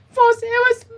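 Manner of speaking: choked start in a high, loud cry; real crying. Real, not acted. Artist starting to tell viewers what went wrong, crying. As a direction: try to get the words out through tears. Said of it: "I'm just trying not to cry".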